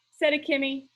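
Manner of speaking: ordinary delivery, nothing marked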